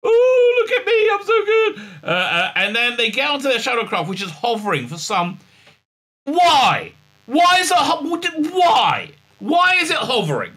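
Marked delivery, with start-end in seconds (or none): 0.0-1.8 very high mocking squeal; hammed-up gushing in a high voice; mock self-admiring heroine. Reviewer mocking Burnham's self-regard, gushing high-pitched. 6.2-10.5 high, loud incredulous outburst; hammered-out, drawn whys of comic outrage; exasperated ranting. Acted. Reviewer ranting about a hovering shuttle, exasperated.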